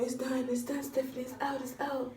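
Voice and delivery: calm her down gently; reassuring dentist. Retold or imitated. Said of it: "he tells me".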